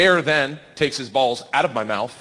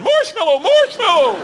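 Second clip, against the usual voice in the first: very high, loud mock scream; hammed-up desperate yelling; panicked cry for help; 6 dB louder than usual. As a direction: shriek it as if being mauled. Screaming for help during bear attack.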